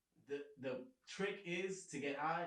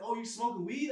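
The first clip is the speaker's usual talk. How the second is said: higher, louder mom, half laughing; accusing mom; 8 semitones higher, 8 dB louder than usual. Retold or imitated. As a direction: catch him out like a suspicious mother. Mom voiced in story.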